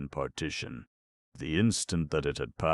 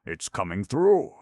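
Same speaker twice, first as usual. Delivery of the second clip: louder alarmed cry; alarmed shout; 5 semitones higher, 8 dB louder than usual. Acted. Sitter to the group at the door, an alarmed shout. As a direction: shout the warning.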